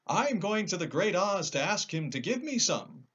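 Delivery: high, loud scarecrow objecting; a straw man protesting; lightly voiced scarecrow.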